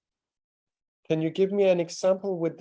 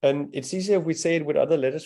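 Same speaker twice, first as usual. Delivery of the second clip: normal talking voice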